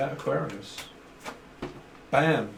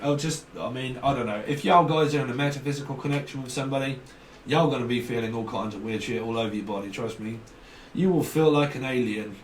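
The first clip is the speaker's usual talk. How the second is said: ordinary delivery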